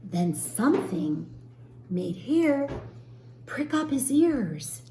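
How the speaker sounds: put-on lively storybook reading for children; teacher reading a story to young children, gently expressive; story narration. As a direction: read it like a picture book aloud to a class.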